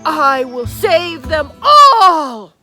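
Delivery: ordinary delivery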